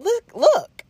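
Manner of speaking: a hint of pleading to get him to listen; urgent plea. To the angry Audi driver, a high urgent plea.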